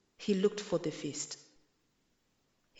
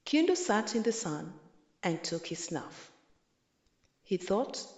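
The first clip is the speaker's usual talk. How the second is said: usual speaking voice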